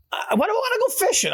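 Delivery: high, fast mock yell; retold outburst. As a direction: bellow it back in disbelief. Retold rant at Kenny.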